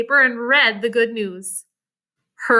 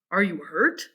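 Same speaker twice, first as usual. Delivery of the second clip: put-on lower, concerned young man; Abe; 7 semitones lower, 5 dB quieter than usual. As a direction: ask gently like a kind older boy. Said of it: "Abe asked".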